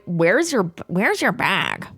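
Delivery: slow, drawn-out guard imitation; slow mocking guard voice; mock rude guard. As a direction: stretch it out like a pushy official.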